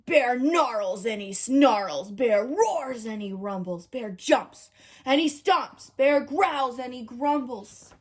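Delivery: ordinary delivery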